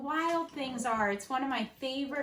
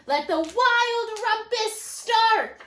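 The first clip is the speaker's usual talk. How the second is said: higher, louder and slow proclamation; cried out; 5 semitones higher, 7 dB louder, slower than usual. Acted. Max to the wild things, loud slow proclamation. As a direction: declare the party open. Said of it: "cried Max".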